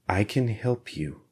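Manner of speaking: an interrogator softly reassuring a drugged prisoner; soft concerned parent. A narrator's reading. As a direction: comfort him like a worried parent. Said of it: "like a concerned parent".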